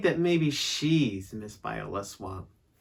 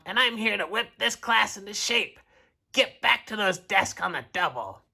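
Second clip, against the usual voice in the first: high, commanding witchy voice; scratchy stern substitute; 6 semitones higher, 3 dB louder than usual. A narrator's reading. Substitute whipping class into shape, scratchy and stern. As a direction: order the class around in a scratchy voice.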